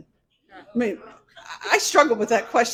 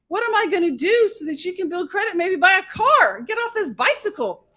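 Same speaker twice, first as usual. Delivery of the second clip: exasperated lecture; 7 semitones higher, 4 dB louder than usual. Retold or imitated. Retold lecture to young voter.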